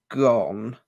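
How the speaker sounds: slow, despairing elf word; mournful elf. A narrator's reading. Elf tells Harry the locket is gone. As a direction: let the word drop like a loss.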